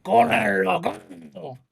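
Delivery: very slow, drawn-out anime bellow; exaggerated anime-style roar; Japanese anime voice. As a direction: stretch it like an anime character. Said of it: "people who talk like that all".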